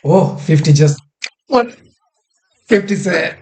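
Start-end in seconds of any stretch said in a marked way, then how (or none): none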